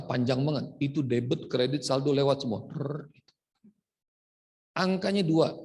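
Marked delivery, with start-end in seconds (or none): 2.7-3.1 printer noise. Retold or imitated.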